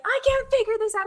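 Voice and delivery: high-pitched imitation of panicked yelling; mock yelling. Friend stuck at the ticket machine, high panicked mock yell. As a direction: wail it like a flustered friend. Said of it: "She is yelling. She's like".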